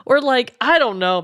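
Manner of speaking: ordinary talk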